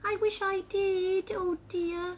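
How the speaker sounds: high, slow, sorrowful character voice; disappointed little egg. Acted. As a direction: lament in a small high voice. The egg answers sadly.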